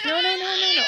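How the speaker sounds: high, quick-repeated no to a little one. Real, not acted. Interrupts herself to stop fussing baby.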